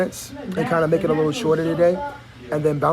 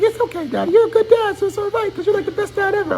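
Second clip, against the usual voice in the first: put-on much higher, sweet little girl voice; little daughter voice; 14 semitones higher than usual. Dad voicing his little daughter forgiving him, high and sweet. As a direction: play your small daughter comforting you. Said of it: "She's like".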